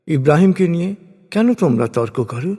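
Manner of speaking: ordinary delivery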